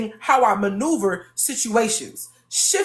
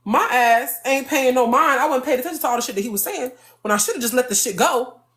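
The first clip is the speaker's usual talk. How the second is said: ordinary talk, nothing marked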